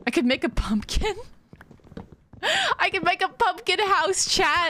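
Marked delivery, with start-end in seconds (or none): 2.4-4.3 giggling through the words, pitched up; laughing. Real, not acted. Streamer laughing to chat about a pumpkin house, giggling. Laughing at own silly idea. 4.3-4.7 a loud, high call to chat. Real, not acted. Streamer calls out to chat, loud and high.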